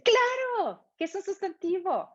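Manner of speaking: high, bright exclamation then question; imitating an eager student. A student who finally gets grammar, eager exclaim. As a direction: play the adult learner suddenly getting it.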